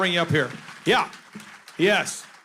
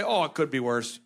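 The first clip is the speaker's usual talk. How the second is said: low, understated Minnesotan voice; Minnesotan accent; 6 semitones lower than usual. Acted. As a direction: dour Minnesotan understatement, pitched down. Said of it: "They would say".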